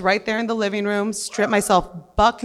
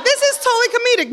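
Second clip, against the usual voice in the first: mock amazement; 4 dB louder, much faster than usual. Mock reaction to coke plate.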